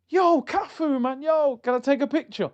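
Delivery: high excited fan voice, calling out; starstruck fan. Retold or imitated. Fan asking Cafu for a picture, high and starstruck. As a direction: gush at your hero for a photo. Fan approaches a football legend.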